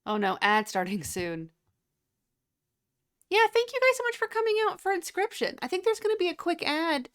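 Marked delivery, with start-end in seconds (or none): 3.3-7.1 high, fast host voice; streamer outro. Real, not acted. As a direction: rattle off the outro thanks.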